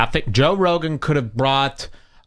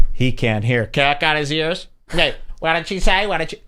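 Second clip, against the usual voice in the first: ordinary talk, nothing marked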